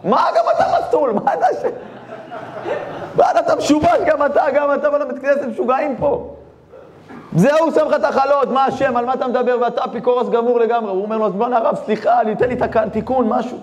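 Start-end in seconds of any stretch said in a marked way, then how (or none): 0.0-10.9 angry rebuke. Acted. Rabbi rants at both. 11.2-13.6 crying apology. Acted. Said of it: "הוא ממש בוכה".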